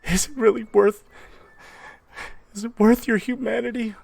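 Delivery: high falsetto, halting appeal; high, imploring wife; pleading mother. Acted. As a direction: plead with your husband in a woman's voice. Mother pleads in acted quarrel.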